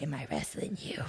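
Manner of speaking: very soft, low retold question; quiet imitation. Wrestling idol sizing up newcomer in the locker room, imitated very quietly. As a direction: imitate her, barely audible.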